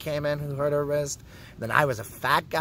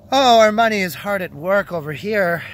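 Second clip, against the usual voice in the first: higher, louder mock praise; sarcastic cheer; 6 semitones higher, 9 dB louder than usual. Real, not acted. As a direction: crow sarcastically, hitting the opening hard. Mocking praise of Canadian healthcare.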